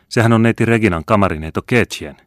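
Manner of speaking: high, loud outburst; surprised exclamation. A narrator's reading. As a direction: exclaim as you recognize someone. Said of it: "huudahti Bertel".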